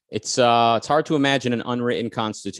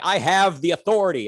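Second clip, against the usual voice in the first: lightly voiced king, pitched higher; insistent king; 7 semitones higher than usual. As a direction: assert royal authority in a slightly put-on voice. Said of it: "the king is, like, trying to argue".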